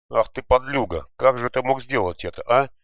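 loud, high furious reproach; accusing a classmate, loud and angry; angry accusation. A narrator's reading. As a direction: lunge at the traitor with the words. Yankel rushes at Goga.

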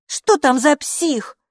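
high, slow, indignant question; exasperated child. A narrator's reading. Children suffer the endless doorbell, exasperated high protest.

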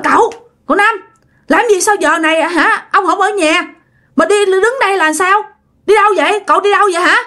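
high-pitched bewildered questions; acted puzzled concern; concerned questioning. Nephew finds uncle, questions him.

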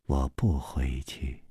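slow, soft, deliberate refusal; quietly measured, one word at a time. A narrator's reading. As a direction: set down each word separately, low and quiet. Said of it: "一字一字的说".

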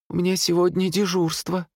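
high-pitched female voice; nurse's voice. A narrator's reading. Nurse explaining herself to police, high female voice. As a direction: answer high, as a woman.

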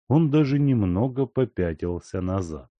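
ordinary delivery

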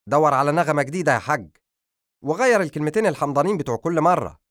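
high, loud fed-up son; exasperated defiance. Acted. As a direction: hurl back impatience at a lecturing father. Son defies father's lecture.

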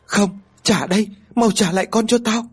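high, desperate insistence; frantic demand. A narrator's reading. Grieving mother refusing comfort, high frantic demand. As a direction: demand the child back, pitched high and frantic. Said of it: "vẫn liên tục đòi con như vậy".

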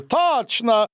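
sudden high cry of recollection; delighted exclamation. A narrator's reading. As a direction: exclaim as the memory suddenly comes back. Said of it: "воскликнул Сильвио". (радостный возглас внезапного узнавания)

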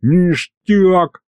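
high, gleeful cry; a pleased little demon's cheer; delighted demon. A narrator's reading. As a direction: exclaim it with delight. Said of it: "обрадовался бес".

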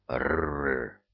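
low rumbling growl; monster growl. A narrator's reading. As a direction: growl like a dull beast.

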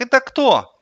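put-on high, loud little boy; child voice. A narrator's reading. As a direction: ask in a small boy's high voice. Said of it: "Он говорил в телефон детским голосом". (детский голос)

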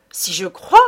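put-on young man exclaiming to the judge. A narrator's reading. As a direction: fire it back eagerly. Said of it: "s'écria le jeune homme".

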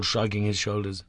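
plain reading voice

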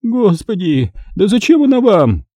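high-pitched distressed woman; distressed captive. Acted. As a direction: ask in high-voiced bewilderment. Captive baffled by the interrogation.

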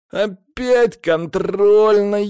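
loud, high, drawn-out wail; hammed-up lament; woeful cry. A narrator's reading. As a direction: wail it in dismay, stretching the vowels. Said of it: "печальный возглас Сани".

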